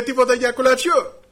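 high, loud question thrown across to them; calling out. Acted. Christ calls out to the fishermen.

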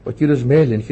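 normal talking voice

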